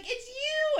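high-pitched starstruck squeal; excited fan. Retold or imitated. Fan spots the podcast host, excited. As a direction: act out the fan's thrilled recognition. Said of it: "she got all excited".

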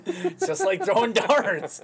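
slow, laughing through the words. Real, not acted. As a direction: crack up while saying it. Cracking up over darts joke.

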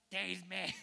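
creepy preacher-played demon. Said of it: "That's my demon voice".

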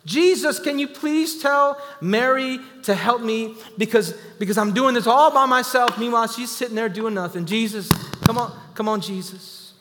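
high, loud, exasperated pleading; a frustrated sister calls on Jesus for help, loud and high; mock yelling. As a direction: yell for help like a fed-up sibling. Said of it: "He yells at his little brother".

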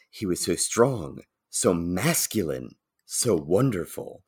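soft, slow mock swoon. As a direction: sigh it out like a smitten heroine, a touch hammed-up. Jane swooning over Thor.

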